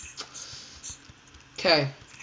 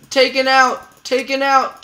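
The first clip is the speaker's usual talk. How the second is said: high, loud victory callout; excited kill call; 7 semitones higher, 8 dB louder than usual. Real, not acted. Player telling teammate the enemy is down, excited shout. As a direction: call out the kill, pitched up and punchy.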